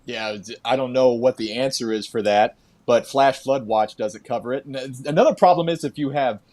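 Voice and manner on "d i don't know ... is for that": ordinary delivery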